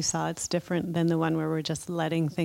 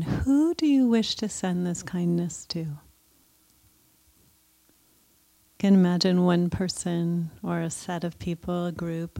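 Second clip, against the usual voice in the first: guided meditation; 2 semitones higher than usual. Acted. Leads a loving kindness meditation.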